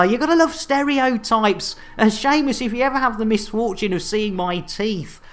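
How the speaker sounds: ordinary talk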